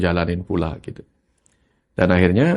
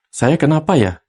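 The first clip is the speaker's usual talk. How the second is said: anxious self-question; 5 semitones higher, 5 dB louder than usual. Shown as a demonstration. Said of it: "orang cemas".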